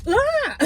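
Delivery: a high startled yelp that breaks into a laugh; mock fright squeal into a laugh. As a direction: shriek as if falling, then laugh. Wall opens, she falls.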